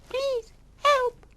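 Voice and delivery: a tiny creature calling for help; very small voice. Acted. Lost rabbit at the door pleading, tiny and very high. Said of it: "said a very small voice".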